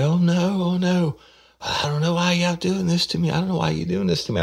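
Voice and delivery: very high falsetto mock wail; hammed-up helpless protest in a squealing pitch; imitating panicked suspect. Suspect protests to the detectives, imitated high-pitched wailing. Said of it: "And she's like".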